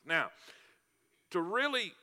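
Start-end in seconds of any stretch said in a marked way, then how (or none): none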